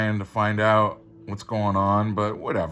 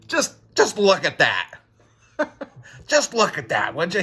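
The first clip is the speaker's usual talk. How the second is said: laughing; 8 semitones higher, 4 dB louder than usual. Real, not acted. Laughing at the cyborg drawing.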